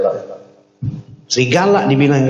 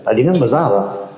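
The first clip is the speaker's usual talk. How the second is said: ordinary talk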